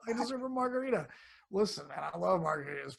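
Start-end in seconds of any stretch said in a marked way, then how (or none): 0.1-1.1 quick, high, hammed-up whine of entitlement; half-joking indulgent plea; mock self-indulgent. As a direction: play the treat-deserving excuse, sped up and high. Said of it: "Like, like".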